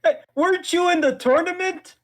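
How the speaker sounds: high, loud hey; calling out. Acted. Character calling to the fighter across the room.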